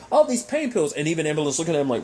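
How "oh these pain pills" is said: high, mocking sing-song taunt. Clint taunting Bonham, high mocking tone. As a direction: tease like a smug villain flaunting stolen pills. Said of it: "it's like".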